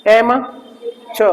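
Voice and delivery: "एम ... च" in two separate pieces; split pronunciation. Shown as a demonstration. Teacher having students read along, "एम", pause, then "च". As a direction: pause between "एम" and "च", final र silent. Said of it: "थोड़ा च की sound लेके आना है".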